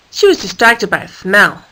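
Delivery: ordinary delivery, nothing marked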